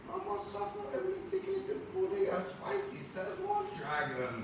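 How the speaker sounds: high, loud cartoonish put-on cousin voice; exaggerated high cousin voice. Teacher reading the comic to the class, high cartoonish cousin voice. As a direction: play the visiting cousin big and squeaky.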